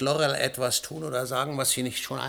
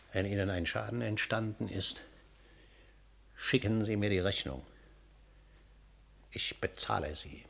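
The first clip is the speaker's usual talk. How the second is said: much softer and lower, drained; exhausted quiet; 6 semitones lower, 10 dB quieter than usual. Acted. Husband sends the scientist away, drained and quiet. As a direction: speak as if emptied out.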